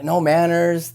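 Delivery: ordinary talk, nothing marked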